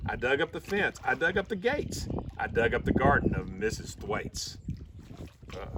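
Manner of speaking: usual speaking voice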